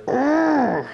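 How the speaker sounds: put-on high exasperated kid groan; mock whiny ugh; frustrated groan. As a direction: groan like a fed-up nine-year-old. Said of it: "He's like".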